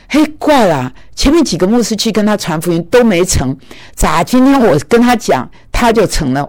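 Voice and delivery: half-laughing amazement; amazed. Real, not acted. Preacher tells congregation of the surprising conversion, amazed. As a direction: marvel aloud at how easily it worked.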